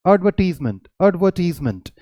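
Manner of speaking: एडवर्टीजमेंट said "ud-VUR-tiz-munt"; British pronunciation. Shown as a demonstration. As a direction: open एडवर्टीजमेंट with a neutral "ud". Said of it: "British English में इसकी pronunciation है". (एडवर्टीजमेंट को "अडवर्टीज़मेंट" बोलें)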